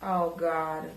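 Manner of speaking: usual speaking voice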